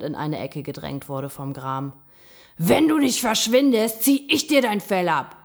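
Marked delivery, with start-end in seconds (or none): 2.6-5.4 loud, raised angry yell; threatening shout. A narrator's reading. Gram corners the raccoon dog, shouting threats. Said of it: "schrie der Gram".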